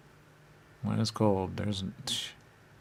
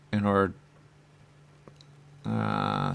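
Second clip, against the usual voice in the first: usual speaking voice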